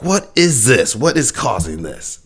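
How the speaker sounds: high, slow mock-curious voice, breaking into a laugh; nosy curious driver. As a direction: peer over and wonder, hammed-up.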